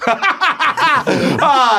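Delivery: high, overdone fake laugh; hammed-up nervous cackle; nervous forced laugh. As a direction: overcompensate with a too-big laugh. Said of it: "overcompensate and nervously laugh".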